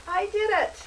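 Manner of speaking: imitating a happy surprised student; pleasantly surprised. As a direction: squeal it like you finally managed it. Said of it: "you will be pleasantly surprised and say".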